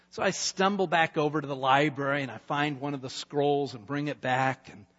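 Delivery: ordinary delivery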